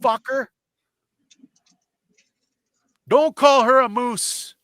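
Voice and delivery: high, slow confronting voice; retold angry telling-off. Narrator confronting the husband, high slow angry. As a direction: square up to the man, slow and high. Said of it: "And I said".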